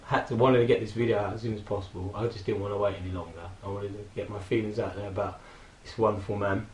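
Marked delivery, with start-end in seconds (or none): none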